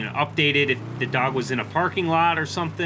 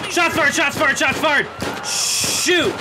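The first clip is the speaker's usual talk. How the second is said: much higher and louder, frantic alarm cries; panicked shouting; 13 semitones higher, 5 dB louder, much slower than usual. Acted. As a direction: yell the alarm as the gun comes out. Suspect pulls a gun, panic.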